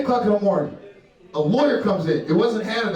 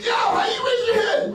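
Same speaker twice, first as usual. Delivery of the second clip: excited disbelief; 14 semitones higher than usual. Real, not acted. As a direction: shout it out, thrilled someone answered. Surprised at crowd answer.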